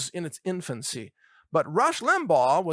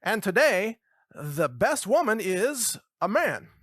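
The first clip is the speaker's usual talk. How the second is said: higher, drawn-out announcer proclamation; slow, raised-pitch award announcement; mock announcer; 6 semitones higher, 4 dB louder, slower than usual. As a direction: announce a winner with mock ceremony. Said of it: "I can just see the announcer".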